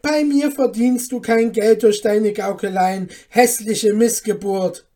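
very loud, angry abuse; wrathful. A narrator's reading. Mother scorning the dwarf as a trickster, wrathful. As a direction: hurl the insult at full volume. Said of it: "entgegnete Frau Hanne zürnend".

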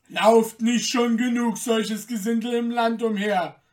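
guard scornfully calling out; indignant call. A narrator's reading. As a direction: call it out in contempt. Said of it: "rief einer der Wächter".

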